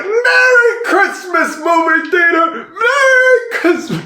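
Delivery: high, slow, shouted Merry Christmas; joyful shout. Retold or imitated. George running through town, high slow joyful shout. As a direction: yell it to the whole town, overjoyed. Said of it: "he's like".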